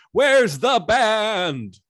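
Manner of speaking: very loud, high, long-drawn bellow; escalated demanding shout. Acted. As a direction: stretch every word into a mock-outraged holler. Mayor's demand repeated, escalated.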